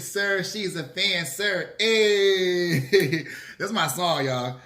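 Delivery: usual speaking voice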